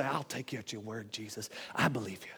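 low, soft declaration of belief; soft, intimate acceptance; quiet trust. Acted. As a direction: say it privately, low and hushed. Believer's quiet reply to Jesus.

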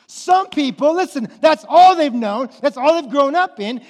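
loud, high, fired-up preaching; full-force preaching. Acted. Preacher fired up, driving home that Jesus disrupts life. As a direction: preach it out at the congregation.

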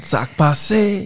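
high, put-on Haitian Creole greeting; Haitian accent. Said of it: "say it like you Haitian".

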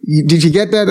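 high, fast Brooklyn New York Italian voice; Brooklyn Italian guy. Retold or imitated. Brooklyn Italian truck guy giving a stranger directions, Brooklyn accent. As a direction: do the helpful Brooklyn Italian workman. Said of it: "very Brooklyn, New York, Italian guy".

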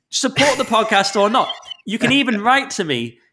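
high-pitched indignant protest; indignant rant. Real, not acted. Host berating a reviewer who withheld a star, indignant. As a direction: protest it high and heated, really aggrieved.

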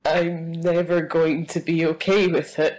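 normal talking voice

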